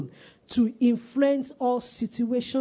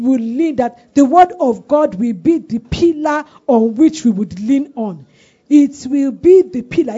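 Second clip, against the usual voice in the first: loud, raised preaching voice; fervent prayer; 4 semitones higher, 8 dB louder than usual. Real, not acted. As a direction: lead the congregation in forceful, building prayer. Leads congregation in fervent prayer.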